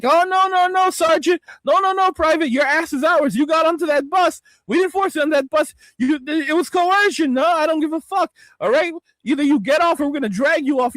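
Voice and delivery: mocking sergeant. Sergeant mocking a private who refuses to leave, sneering. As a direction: taunt the private like a drill sergeant.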